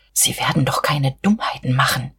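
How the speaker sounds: urgent, pleading whisper; imploring whisper. A narrator's reading. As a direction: whisper it as a secret warning. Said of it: "flüsterte er beschwörend".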